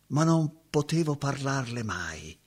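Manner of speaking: ordinary talk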